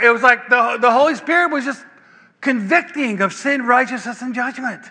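ordinary talk

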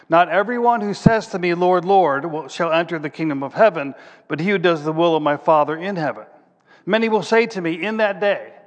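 preacher reading Jesus' words aloud with force; scripture reading. Acted. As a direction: proclaim the verses to the congregation.

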